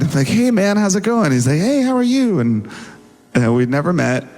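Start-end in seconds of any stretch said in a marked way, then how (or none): 0.3-1.3 higher-pitched mock-casual hello; acted eager greeting. Retells greeting [name]. 1.6-2.4 high-pitched voiced hello; imitated greeting. Voices [name]'s reply greeting.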